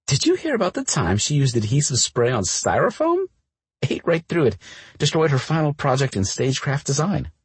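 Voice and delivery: high, gleeful storytelling; gleeful teasing. Acted. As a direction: spill the embarrassing stories with glee. Cora gleefully telling embarrassing stories.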